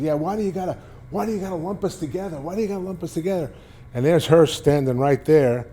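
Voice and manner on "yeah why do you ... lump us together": high, fast whiny complaint; mock a pitcher's whining. Ojeda complaining, mimicked high.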